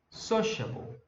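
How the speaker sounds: sociable with SO pitched high; teacher's demo of sociable, punching SO; stress on first syllable. Shown as a demonstration. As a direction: lift the first syllable of sociable, then drop. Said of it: "dar uma entonação maior pra essa sílaba".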